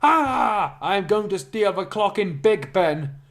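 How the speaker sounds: high, loud cackling villain shout; villain cackle. Acted. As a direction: crow your evil plan with a cackle. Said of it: "And he cackled".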